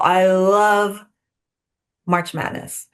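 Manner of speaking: LOVE drawn right out, then a drop; emphatic love. Real, not acted. Fan telling agents about March Madness, love drawn out emphatically. Gushing over her favourite event.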